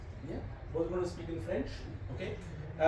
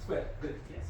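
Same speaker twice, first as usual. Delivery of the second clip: ordinary talk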